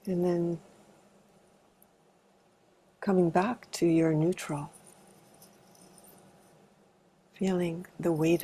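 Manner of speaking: calm, unhurried meditation guidance with long pauses; spacious meditation leader's delivery; guided meditation. Acted. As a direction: gently guide a group into arriving, leaving space between phrases. Leads a body meditation.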